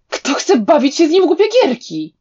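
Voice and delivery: a mild narrated outburst; sudden shout. A narrator's reading. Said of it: "krzyknął nagle Shueyan". (nagły, podniesiony okrzyk)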